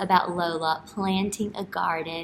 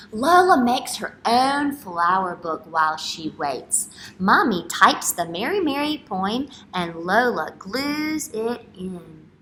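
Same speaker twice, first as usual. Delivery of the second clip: louder, slow storytime read; reading to children; 3 semitones higher, 5 dB louder, slower than usual. Acted. Teacher reading a picture book aloud to kids. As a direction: make each page sound like a treat.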